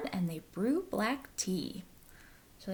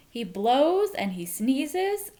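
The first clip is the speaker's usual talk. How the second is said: loud; 5 semitones higher, 7 dB louder, slower than usual. A narrator's reading. Building to the sneeze.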